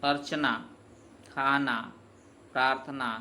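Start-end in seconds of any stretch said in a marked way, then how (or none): none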